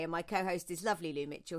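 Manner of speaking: normal talking voice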